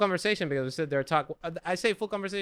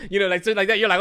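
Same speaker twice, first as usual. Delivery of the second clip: ordinary delivery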